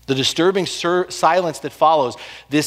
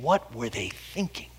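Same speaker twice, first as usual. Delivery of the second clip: hushed, lower, incredulous question; baffled aside; 4 semitones lower, 10 dB quieter than usual. Acted. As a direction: drop to a soft, puzzled undertone. Congregation's baffled question voiced.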